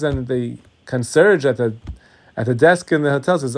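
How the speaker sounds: ordinary delivery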